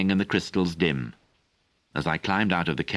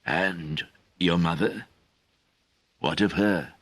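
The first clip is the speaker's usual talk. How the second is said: gentle; slower than usual. A narrator's reading. Old Galapas gently questions Merlin, slowly. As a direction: coax him gently. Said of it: "He persisted gently".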